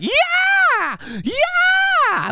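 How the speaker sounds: high, loud mock scream; imitated frenzied shriek; manic kid's yell. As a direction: yell it like a hyped-up kid headbutting a ball. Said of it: "headbutt it and go like".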